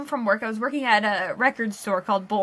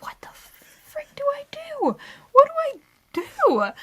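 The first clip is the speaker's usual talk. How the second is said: mock helpless panic; 14 semitones higher than usual. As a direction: squeak it like you're helplessly stuck. Retold helpless panic beside the owl.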